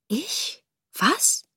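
high, soft frightened questions; small voice, taken aback; startled. A narrator's reading. Girl startled by the soldiers' question, frightened disbelief. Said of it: "fragte das Mädchen erschrocken".

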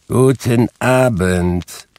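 slow, drawn-out goodbye in an odd voice. A narrator's reading. Scrooge dismissing his nephew a second time, slow curt goodbye. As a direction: shut the talk down, slowly.

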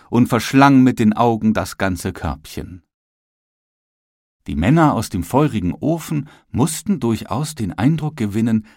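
usual speaking voice

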